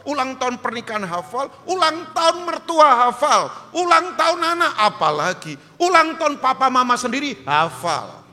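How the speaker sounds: high, loud, emphatic preacher; full-force preaching. Real, not acted. Preacher boasting he remembers anniversaries, forceful preaching. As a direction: drive each item home loudly.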